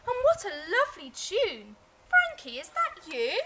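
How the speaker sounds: high, loud, thrilled; delighted. Acted. Mother asking Frankie, thrilled. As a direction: gush with surprise. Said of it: "cried his mother".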